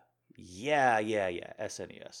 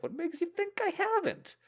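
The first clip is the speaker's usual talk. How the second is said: mock indignant retort; 4 dB louder than usual. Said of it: "I was like".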